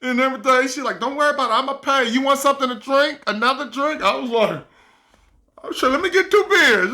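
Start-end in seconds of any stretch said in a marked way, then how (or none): 1.0-4.0 voicing the woman. Woman offering to pay. 5.6-6.9 eager order. Retold or imitated.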